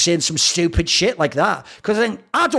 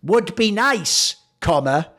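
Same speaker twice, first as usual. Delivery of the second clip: slow, spaced-out quoting; deliberate read-out; slower than usual. Retold or imitated. Host quoting a manager's reply to viewers, slowly and deliberately. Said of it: "he just replies to me and goes".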